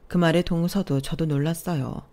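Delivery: plain reading voice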